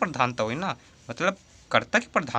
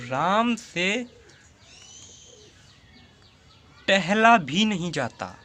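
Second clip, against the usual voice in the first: slow, deliberate, each word drawn out; slow dictation; 5 semitones higher, much slower than usual. Shown as a demonstration. Teacher slowly dictating an example sentence to students. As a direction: spell the example out slowly for the class. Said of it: "जैसे एक उदाहरण ले लेता हूँ".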